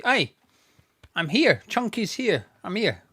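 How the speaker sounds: loud, high and bouncy; playful announcement. Real, not acted. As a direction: announce yourself to the chat, cheekily. Jokey answer to chat.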